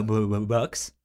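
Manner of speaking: ordinary talk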